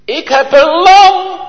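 high, loud cry of relief; jubilant shout. Retold or imitated. Boy crying out to the angel that he holds a lamb, high and loud. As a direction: cry it out as if saved.

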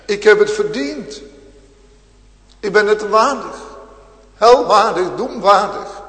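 full-force sermon voice; fiery preaching. Acted. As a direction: proclaim the guilt aloud.

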